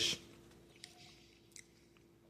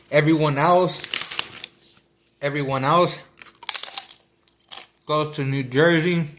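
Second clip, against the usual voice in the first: talking while chewing food; mouth full. Real, not acted. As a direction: speak through a mouthful. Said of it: "Sorry, I'm eating with my mouth on".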